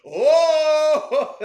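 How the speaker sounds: loud, high sustained war cry; high whoop ending in a laugh; long heroic holler. Acted. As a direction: belt out a held howl that breaks into laughter. End of the cartoon battle cry.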